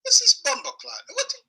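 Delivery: squeaky high disbelief, almost crying out; can't believe what he's hearing; high, strained exasperation; incredulous. Real, not acted. Outraged disbelief at the guy.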